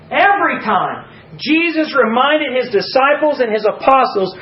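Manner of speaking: high, emphatic pulpit voice; impassioned preaching. Real, not acted. Preacher telling how disciples reacted to Christ, impassioned.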